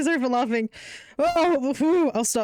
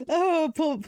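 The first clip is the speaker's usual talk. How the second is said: normal talking voice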